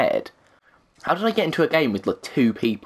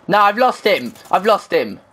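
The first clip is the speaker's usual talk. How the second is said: frustrated; 7 semitones higher, 3 dB louder than usual. Real, not acted. Losing enemy in game.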